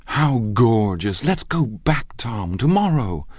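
high, slow, delighted exclamation; gushing delight. Acted. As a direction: swoon over the idea.

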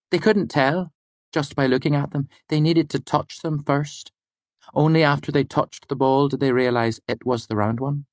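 high-pitched student storyteller; Fela. Acted. Student telling the lecturer a medical discovery, high-pitched. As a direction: explain the fact in a high, even voice. Student tells her fact.

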